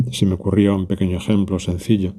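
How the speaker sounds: normal talking voice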